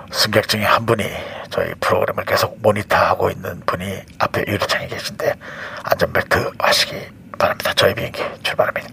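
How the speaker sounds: low, breathy captain's cabin announcement; airline pilot. Pretend. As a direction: whisper like a pilot on the PA.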